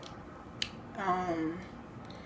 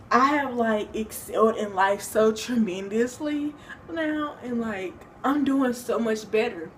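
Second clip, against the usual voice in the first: usual speaking voice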